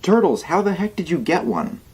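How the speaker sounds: high curious viewer voice; imagined viewer voice. Retold or imitated. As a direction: pitch up as an eager fan. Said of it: "what you're wondering".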